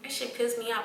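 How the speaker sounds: ordinary talk, nothing marked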